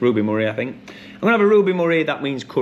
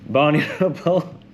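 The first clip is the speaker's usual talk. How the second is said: laughing; 3 semitones higher than usual. Real, not acted. Host repeating the question after a silly impression, laughing. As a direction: laugh breaking into the name.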